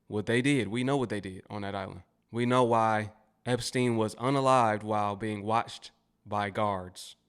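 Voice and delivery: ordinary talk, nothing marked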